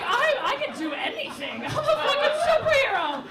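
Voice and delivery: loud, high, laughing brag; hammed-up triumphant shout; invincible boast. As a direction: crow like you're unstoppable.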